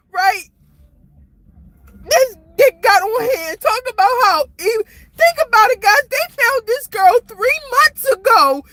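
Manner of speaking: high-pitched, tearful outpouring; real frustrated, tearful upset. Real, not acted. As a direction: plead your case through real tears. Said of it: "I'm so frustrated".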